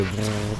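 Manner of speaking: short vocal "brrr" noise; imitated sound effect. Gamer showing viewers a sound heard in the game, imitated brrr. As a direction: mimic the game's little rumbling sound. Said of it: "comme ça".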